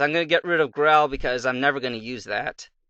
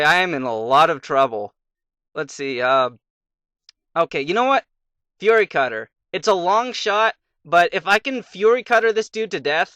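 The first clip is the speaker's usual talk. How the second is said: ordinary delivery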